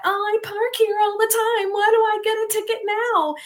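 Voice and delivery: high, whiny mock complaint; all upset. Mimicking the neighbor who found a parking ticket, upset and high-pitched. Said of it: "she was getting all upset".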